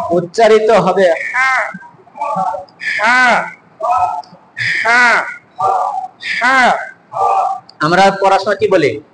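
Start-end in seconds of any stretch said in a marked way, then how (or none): 1.3-6.7 "হা" breathed from the middle of the throat. Shown as a demonstration. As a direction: say each "হা" separately from mid-throat. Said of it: "হলকের মধ্যখান থেকে".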